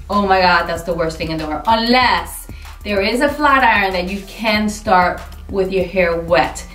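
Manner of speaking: ordinary talk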